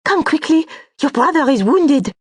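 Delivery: high, loud alarmed cry. Acted. Companion bursting in to warn of the wound, alarmed cry. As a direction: call out in panic from the doorway. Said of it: "crying out".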